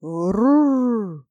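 rolled growling rrr; jaguar growl. A narrator's reading. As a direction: growl like a big cat. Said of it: "Ягуар опять".

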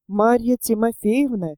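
plain reading voice

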